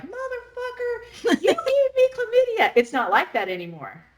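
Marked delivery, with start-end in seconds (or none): 0.0-2.7 high, far-off mock shriek of outrage; hammed-up indignant high voice; mock outraged accusation. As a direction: squeal it like a betrayed lover. Mock angry accusation quoted.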